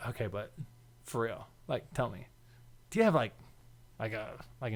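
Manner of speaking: very soft, low, halting question; hushed, hesitant asking. Retold or imitated. Storyteller asking coworker a delicate question, hushed and hesitant. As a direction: tread carefully, almost under the breath.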